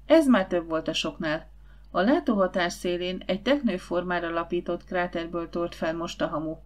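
usual speaking voice

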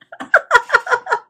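high, loud laugh; burst of laughter. Real, not acted. Reader amused by the bishop's retort, loud real laughter. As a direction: crack up in a high-pitched cackle.

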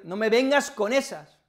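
firm rebuke. Retold or imitated. To someone making excuses, a firm annoyed rebuke. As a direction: shut down the excuse. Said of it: "yo le digo".

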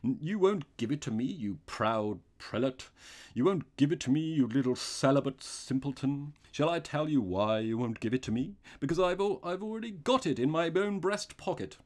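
gloating robber, loud; triumphant cry. A narrator's reading. Robber mocks the priest who refused him, crying out. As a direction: crow over him at full voice. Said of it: "he cried".

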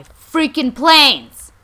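sudden loud, drawn-out yell; angry outburst. Pretend. Player still hunting for the planes, angry outburst. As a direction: burst out yelling in frustration.

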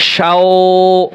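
শাও with the vowel held long; stretched vowel. Shown as a demonstration. Teacher showing students how to stretch শাও. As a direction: draw out শাও slowly. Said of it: "এভাবে টানতে হবে".

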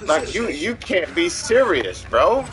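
loud, high-pitched angry ranting; so mad. Real, not acted. Gamer ranting about being punished in his game, so mad. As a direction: vent loudly with big falling cries. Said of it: "I'm so mad right now".

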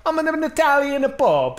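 put-on slow, high Italian Pope accent. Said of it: "crude Italian stereotype".

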